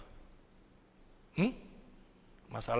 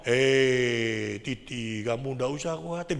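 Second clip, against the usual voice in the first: low, comforting divine voice; Roh Kudus; 5 semitones lower, much slower than usual. Acted. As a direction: drop the pitch and reassure, drawing out the first word. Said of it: "Dia ngomong". (suara rendah yang menghibur)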